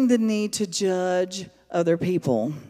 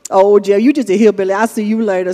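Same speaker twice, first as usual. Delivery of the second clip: put-on old Cajun woman; 6 dB louder, faster than usual. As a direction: ham up an old Cajun lady calling out. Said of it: "she's an old Cajun woman".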